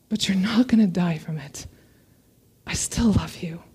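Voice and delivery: soft whispered reassurance; tender whisper. Retold or imitated. Parent whispers love to the grounded child. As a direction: whisper it like comforting a sulking child.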